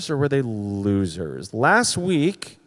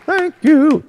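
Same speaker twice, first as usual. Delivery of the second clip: high loud thank you; 13 semitones higher, 8 dB louder than usual. Pretend. Repeated thanks, varied voice.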